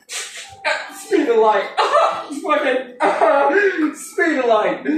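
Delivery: cracking up mid-line; laughing through it. Real, not acted. He mocks the speed of light line, laughing. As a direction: giggle through the words. Laughing while mocking the claim.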